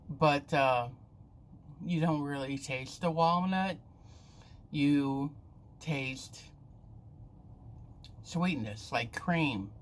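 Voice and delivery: talking around candy; chewing while talking. Real, not acted. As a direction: mouth partly full. Seen 4: cheek bulge and pressed, working lips between words; candy review.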